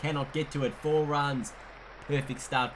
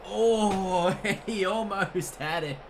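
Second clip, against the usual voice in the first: higher, louder, laughing disbelief; excited near-miss; 7 semitones higher, 4 dB louder, slower than usual. Real, not acted. Near miss on hat-trick.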